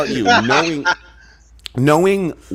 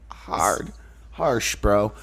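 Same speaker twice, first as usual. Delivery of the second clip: usual speaking voice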